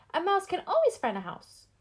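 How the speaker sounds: very high squeaky little voice; mouse voice. Acted. Mouse asks Gus, high squeaky voice.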